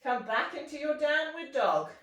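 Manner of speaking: ordinary talk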